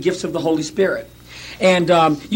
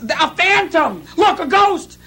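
high, slow frightened cry; mock panic; 9 semitones higher, slower than usual. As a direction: shout like panicked fishermen. Retells the disciples' panic.

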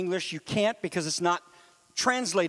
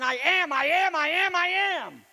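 rising proclamation; 8 semitones higher than usual. Acted. Preacher climaxing on Jesus' words.

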